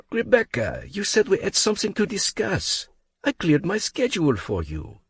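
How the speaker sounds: put-on a French accent complaining he cleared his day; aggrieved French accent. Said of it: "his smooth accent".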